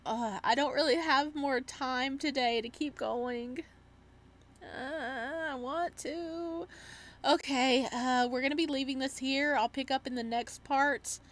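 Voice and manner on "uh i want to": mock whine. Regrets stopping the game.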